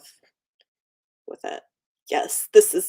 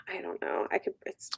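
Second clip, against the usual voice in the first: trailing whisper; 13 dB quieter than usual. Real, not acted. Trailing off while trying to remember.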